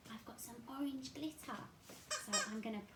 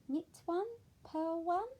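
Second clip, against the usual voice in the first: sing-song knitting; 8 semitones higher, 5 dB louder than usual. Acted. Penguin knitting rhyme.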